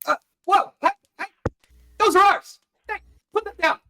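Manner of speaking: very high, squeaky goblin protest; alarmed goblin. Acted. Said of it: "one of the other ones go".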